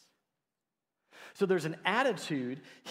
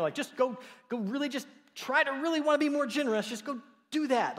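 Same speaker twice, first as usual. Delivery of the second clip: mock exasperation; 11 semitones higher, 5 dB louder than usual. Mocking an empty exhortation.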